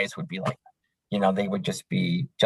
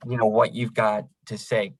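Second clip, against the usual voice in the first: normal talking voice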